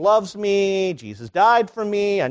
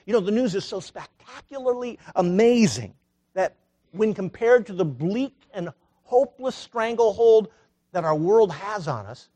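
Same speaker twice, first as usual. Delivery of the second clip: normal talking voice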